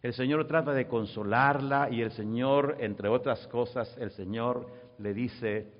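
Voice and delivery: ordinary delivery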